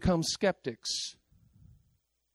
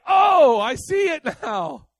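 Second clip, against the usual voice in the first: mock realization; 7 semitones higher, 5 dB louder, slower than usual. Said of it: "And I'm like".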